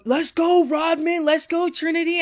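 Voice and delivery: high-pitched sideline cheer; calling out. Retold or imitated. Someone at the game cheering on Trinity, calling out. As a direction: call it out high, like cheering a player on.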